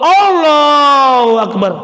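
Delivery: slow, loud drawn-out Allahu Akbar; sloppy man praying at home in a sarong; mock lazy takbir. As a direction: drag it out like a careless prayer.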